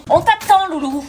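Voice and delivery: calling out. Acted. Mother hurrying her daughter to her father, calling out. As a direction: holler sweetly to hurry them up. Parent hurries child from afar.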